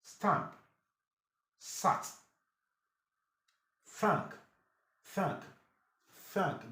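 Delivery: "stamp, sat, thank" each with a short open A. Shown as a demonstration. Teacher drills the short A sound, "thank" kept short. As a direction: model "stamp", "sat", "thank" as separate short-vowel words. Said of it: "it sounds short A".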